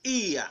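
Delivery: EAR as "ee-ah", no R; British ear syllable. Shown as a demonstration. Teacher shows learners British ear, R dropped. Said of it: "change in British English to".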